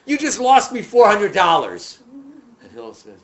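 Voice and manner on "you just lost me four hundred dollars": loud, high acted outrage; angry yelling. Visitor blaming Hillel for the lost bet, angry yelling. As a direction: yell the accusation at full volume. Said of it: "why are you angry".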